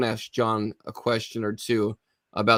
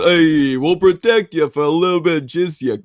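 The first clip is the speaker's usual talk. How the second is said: put-on loud, high Boston mobster accent; 5 semitones higher, 8 dB louder than usual. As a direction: bark it like a Boston thug. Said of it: "You need to say that with a Boston accent".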